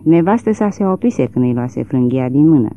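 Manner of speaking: ordinary delivery, nothing marked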